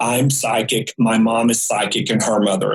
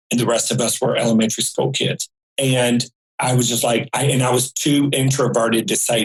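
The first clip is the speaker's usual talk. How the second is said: usual speaking voice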